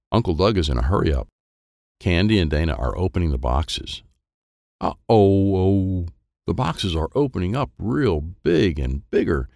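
ordinary delivery, nothing marked

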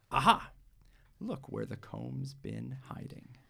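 mock-stern mom, slow and drawn out; tease, then get firm about braiding; teasing mother. Mother scolding Jamila for hiding the comb, mock stern.

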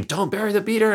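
high-pitched imitation of a yelling teacher; mock scream. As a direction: squeal it like a scolding, without real volume. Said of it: "he'll yell at me, like, scream".

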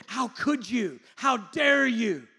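high, shouted rebuke; outraged accusation. Acted. A condemning God standing over someone praying, outraged. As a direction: thunder it down in judgement.

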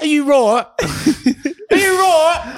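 high, laughing mock Aussie accent. Joking back at his co-host in a mock high Aussie accent.

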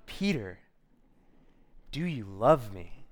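low, slow, deliberate question; gentle Jesus. Acted. Jesus asking Peter a third time, low and solemn. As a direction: ask it slowly, weighing every word. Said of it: "and Jesus asks him".

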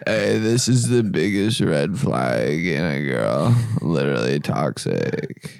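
low slow TikTok lecture; TikTok guy voice. Pretend. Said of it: "can you please do it".

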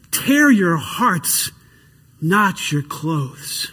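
slow, drawn-out words, dropping at the end; slow solemn reading. Acted. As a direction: pastor reading Joel aloud to the congregation, slowly and solemnly.

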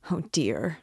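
breathless whispered sigh; whispered despair. Acted. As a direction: let it escape under your breath. Sudden aside in despair.

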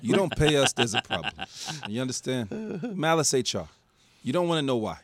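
menacing debt collector, played for laughs; mock mobster. Co-host pretending to collect Malice's unpaid accounts, mobster-style menace. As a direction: lean on them like a wiseguy, pausing between threats. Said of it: "Paulie Walnuts to be the spokesman".

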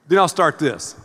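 ordinary talk

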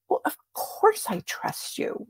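leise geflüstert, fast tonlos; whispered reply. Retold or imitated. Husband's surprised reply, retold.

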